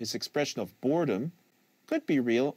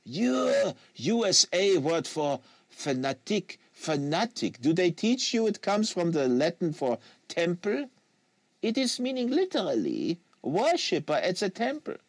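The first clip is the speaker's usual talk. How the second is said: put-on French Canadian accent; 8 semitones higher, 4 dB louder than usual. Quebecois agent lecturing the American on fanatic, French Canadian accent.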